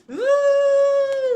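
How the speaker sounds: high, loud, drawn-out "ooh"; long pretend shiver sound; trembling ooh. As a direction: play-act shivering with fright. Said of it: "Can you tremble with me?".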